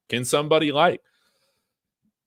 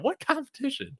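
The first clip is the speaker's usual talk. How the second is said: incredulous; 12 semitones higher, 5 dB quieter than usual. Acted. Incredulous reply to critics.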